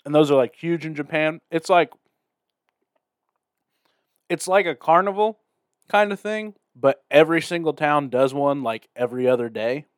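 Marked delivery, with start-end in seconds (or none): 0.0-6.5 talking with a mouth full of food. Real, not acted. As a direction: talk through a mouthful of snacks. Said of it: "I'm gonna talk with my mouth full".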